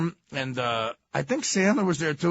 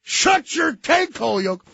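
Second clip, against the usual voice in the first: mock yell; 11 semitones higher, 6 dB louder than usual. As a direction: drag it out and shout. Punchline repeated.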